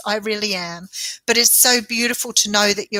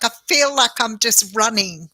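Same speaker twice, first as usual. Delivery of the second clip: usual speaking voice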